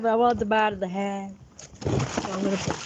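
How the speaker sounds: ordinary delivery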